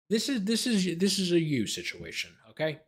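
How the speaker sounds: high, pointed mock scolding; teasing scold. Real, not acted. Telling Atlanta it is their own problem, teasing scold.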